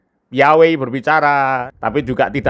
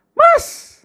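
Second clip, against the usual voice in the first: mas squeaked very high; wife's high surprised mas; different intonation; 3 dB louder than usual. Shown as a demonstration. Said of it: "Kan beda lagi".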